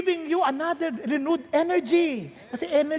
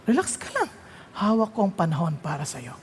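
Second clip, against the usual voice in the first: God reassuring; 10 semitones lower, 3 dB quieter than usual. Acted. God answering the preacher's prayer.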